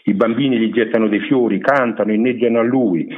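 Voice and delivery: normal talking voice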